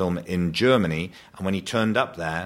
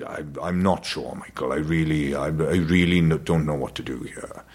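hesitant dad; 5 semitones lower than usual. Retold or imitated. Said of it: "and he said".

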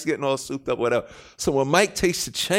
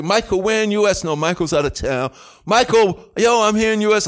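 louder, higher calls; calling out; 7 semitones higher, 6 dB louder than usual. Retold or imitated. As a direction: call out eagerly for Michael. Calling Michael repeatedly.

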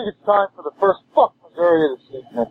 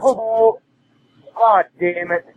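drawn-out groan; 5 semitones higher, much slower than usual. Real, not acted. Angry fan voicemail.

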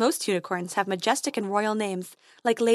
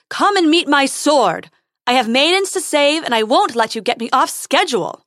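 heroic shout; 5 semitones higher, 8 dB louder than usual. Acted. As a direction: bellow a brave challenge. Prince shouting challenge.

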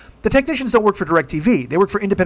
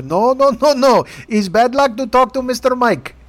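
higher put-on Indian call-center accent; mock Indian accent; 7 semitones higher, slower than usual. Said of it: "maybe the Indians said".

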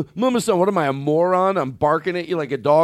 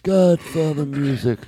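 ordinary talk

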